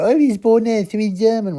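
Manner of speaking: high, loud mocking normie voice. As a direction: sneer it like a smug normie. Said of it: "And all the normies go".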